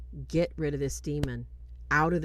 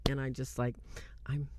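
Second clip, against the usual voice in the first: normal talking voice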